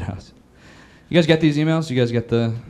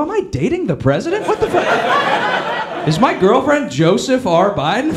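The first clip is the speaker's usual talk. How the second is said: high, incredulous mock outrage; mock disbelief; 6 semitones higher than usual. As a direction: squeak it out in disbelief.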